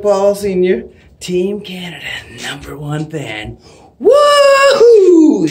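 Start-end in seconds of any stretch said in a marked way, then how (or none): 4.0-5.5 high, full-volume woohoo; fan whoop. Real, not acted. Team Canada fan closing his video, loud high whoop. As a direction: throw out one last big cheer.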